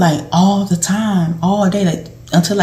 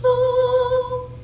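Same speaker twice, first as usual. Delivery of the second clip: a much higher, softer surprised "Oh"; 5 dB quieter than usual. Retold or imitated. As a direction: let it slip out high, quietly surprised. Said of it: "And she was like".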